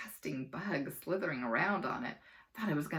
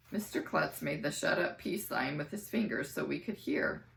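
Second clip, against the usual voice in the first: ordinary delivery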